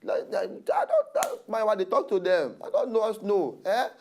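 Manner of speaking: ordinary talk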